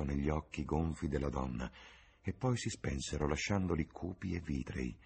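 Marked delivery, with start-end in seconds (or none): none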